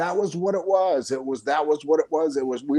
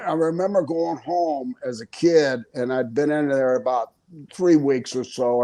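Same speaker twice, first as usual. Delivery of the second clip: usual speaking voice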